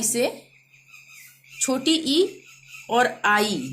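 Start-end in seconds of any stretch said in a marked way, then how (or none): none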